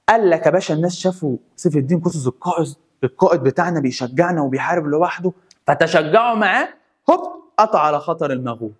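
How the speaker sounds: ordinary talk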